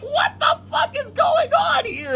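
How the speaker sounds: very high, squeaky retold cry of disbelief; imitated baffled outburst. Imitating friend baffled by the movie, high-pitched outburst. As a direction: mimic a bewildered friend, pitch shooting way up. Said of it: "Clay's like".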